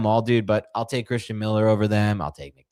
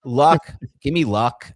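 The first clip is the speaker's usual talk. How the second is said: pleading for luck; 8 semitones higher, 8 dB louder, slower than usual. Acted. Begging Nick for draft luck.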